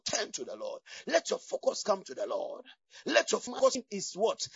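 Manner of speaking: ordinary talk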